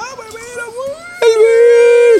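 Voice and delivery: a joking, cartoonish squeak; mock voice. Teasing his friend in a silly high voice.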